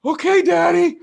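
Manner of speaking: put-on very high, loud child voice; meek little boy. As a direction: say it like a timid kid. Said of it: "I'm like".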